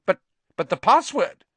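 high, stammering question; stunned disbelief. Acted. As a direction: sputter the question in shock. Teacher stunned by the answer.

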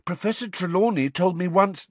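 high, worried schoolgirl voice; Parvati, alarmed. A narrator's reading. Pupil warning her teacher of horrible misfortune, alarmed. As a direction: warn them in a high, anxious voice. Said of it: "looking alarmed".

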